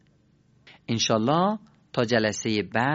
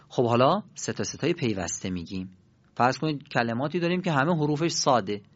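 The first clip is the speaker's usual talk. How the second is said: ordinary talk, nothing marked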